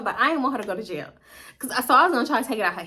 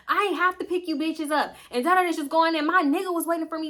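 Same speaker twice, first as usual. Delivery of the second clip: higher-pitched ranting, no longer shouted; mock angry complaint; 6 semitones higher than usual. Cousin complaining about picking them up, mock angry. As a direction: act out an aggrieved driver's rant.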